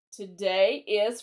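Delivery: drawn-out words for English learners; slow and clear. Shown as a demonstration. Teacher slowing down for students learning English. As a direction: stretch each word out for learners. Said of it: "for all of my friends out there learning English".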